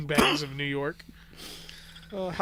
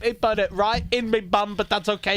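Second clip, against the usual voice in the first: higher mock British accent; hammed-up British chap telling a tale; British guy; 5 semitones higher, 3 dB louder than usual. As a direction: do a comic British bloke. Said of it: "something a British guy would say".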